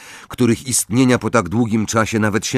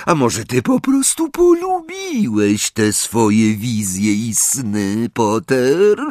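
taunting teacher, higher and drawn out; Snape; 4 semitones higher, slower than usual. Acted. Teacher accuses pupil of liking his visions, drawn-out taunt. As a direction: needle the pupil with a stretched-out question.

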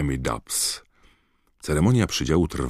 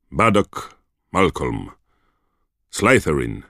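stern roll call; 2 semitones higher, 5 dB louder than usual. A narrator's reading. McGonagall reads name and house.

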